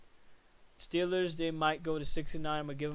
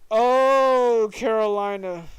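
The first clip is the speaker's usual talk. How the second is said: loud, groaning "Oh, Carolina" with the "Oh" pitched high; exasperated cry; 5 semitones higher, 13 dB louder, much slower than usual. Real, not acted. Podcaster wailing over the Panthers' season, loud and exasperated. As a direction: cry out "Carolina" in despair at the team.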